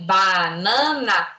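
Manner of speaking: put-on slow, low child reading Banana syllable by syllable. Shown as a demonstration. Teacher imitating a child slowly reading Banana. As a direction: read Banana slowly like a beginner. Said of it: "Aí ela vai ler".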